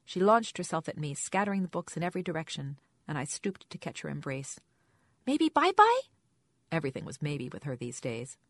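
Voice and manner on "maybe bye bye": put-on high, loud little-child question; loud high toddler voice. A narrator's reading. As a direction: play a hopeful toddler.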